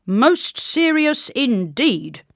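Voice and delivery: drawn-out grave soldier voice. A narrator's reading. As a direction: drag it out like a self-important guard. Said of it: "replied the soldier".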